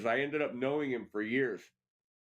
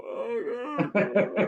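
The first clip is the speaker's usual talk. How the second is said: hammed-up high, loud wail; mock choked-up; 11 semitones higher, 6 dB louder than usual. Son missing dad over old songs, mock choked-up. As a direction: exaggerate a blubbering cry, much higher. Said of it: "I'll get all choked up about my dad".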